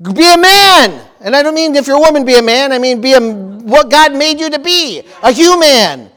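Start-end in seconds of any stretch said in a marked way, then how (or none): 0.0-1.0 loud, high-pitched mock mob-boss reprimand; Godfather scolding. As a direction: bark it like the Godfather slapping him. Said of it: "the Godfather ... he said".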